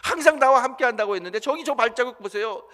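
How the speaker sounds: loud, high, anguished demand; angry protest. Acted. A man confronting Jesus over the lone footprints, loud anguished protest. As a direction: cry out the accusation as if betrayed. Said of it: "예수님께 따집니다".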